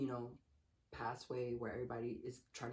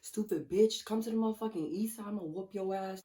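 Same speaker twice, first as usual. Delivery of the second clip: higher, louder retold tirade; yelling at the guy she just punched, raised and heated; going off, yelling; 7 semitones higher, 4 dB louder than usual. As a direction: tear into him, voice up and loud. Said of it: "I was just going off".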